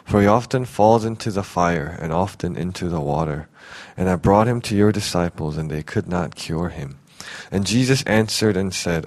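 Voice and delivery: measured Bible reading, unhurried; scripture reading. Acted. Pastor reading scripture to the church, steady reading voice. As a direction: read the passage aloud to the congregation. Scripture read before the sermon.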